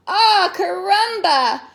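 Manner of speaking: loud slow startled cry. Acted. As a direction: cry out in surprise. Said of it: "cried his grandfather".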